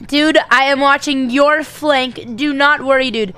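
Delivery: slow, loud mock voice of the teammate; imitating the guy. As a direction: lightly play the odd guy, slow and deliberate. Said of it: "He always talks like that".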